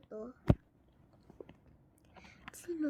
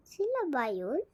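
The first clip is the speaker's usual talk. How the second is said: ordinary talk, nothing marked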